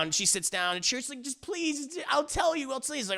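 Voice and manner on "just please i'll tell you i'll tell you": begging. Retold or imitated. Woman begs him.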